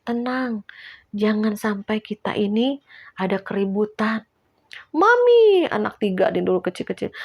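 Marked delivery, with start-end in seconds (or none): 4.9-5.7 put-on high, loud little kid's call; child calling mum. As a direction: cry out like a small child for mum. Mother voicing small child calling.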